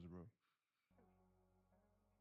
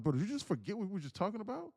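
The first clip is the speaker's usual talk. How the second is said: incredulous; 10 semitones higher, 4 dB louder than usual. Retold or imitated. Streamer retells scolding the user.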